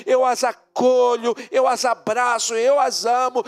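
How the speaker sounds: fervent preaching. Acted. Preacher assuring the congregation of God's love, fervent and rising. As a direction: preach it with mounting passion. Preaching builds to full force.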